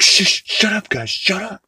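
high put-on shushing, urgent; hushing the party. Partygoer hushing the others, high mock shush. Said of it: "he's like".

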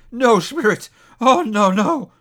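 high, loud desperate protest; anguished cry. A narrator's reading. Scrooge refusing his fate before the spirit, anguished cry. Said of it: "he cried upon his knees".

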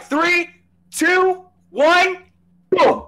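high, excited countdown; countdown hype. Acted. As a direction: count down like a launch. Countdown to show start.

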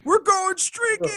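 high-pitched excited yell, drawn out; gleeful shouted movie line; movie quote yell. Pretend. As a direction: belt it like a wild party cry. Quoting movie line, yelled.

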